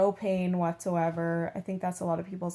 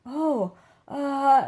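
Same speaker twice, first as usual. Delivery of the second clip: startled nurse; 7 semitones higher, 7 dB louder than usual. Retold or imitated. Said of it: "And she's like".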